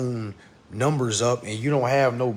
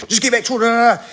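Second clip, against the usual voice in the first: higher, louder mock rushing; impatient mimicry; 10 semitones higher, 8 dB louder, much faster than usual. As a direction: blurt it out like impatient viewers. Mimics impatient viewers rushing him.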